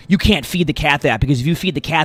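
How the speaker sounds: meme commenter. Retold or imitated. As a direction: fake outrage turning into a joke. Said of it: "they'll be like".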